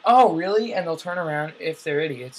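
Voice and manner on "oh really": light surprised rise; mock surprise. Fooled listener turns around, lightly surprised. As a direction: play the gullible listener, a little surprised. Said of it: "they'll be like".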